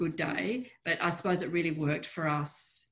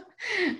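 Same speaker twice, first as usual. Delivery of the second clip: high laugh. Real, not acted. Laughs while showing dog.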